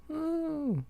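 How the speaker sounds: mock ooh. Host reacting to dead crowd.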